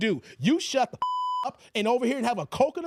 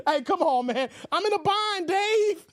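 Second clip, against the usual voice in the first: begging friend; 6 semitones higher than usual. Pretend. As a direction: whine it like a broke buddy. Mimicking a begging viewer.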